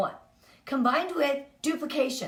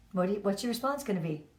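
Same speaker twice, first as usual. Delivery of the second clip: ordinary talk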